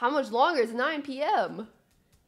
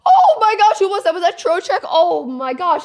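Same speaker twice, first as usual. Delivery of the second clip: loud, higher, breathless celebration; excited; 8 semitones higher, 15 dB louder than usual. Real, not acted. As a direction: keep shouting in disbelief after the goal. Still celebrating the goal.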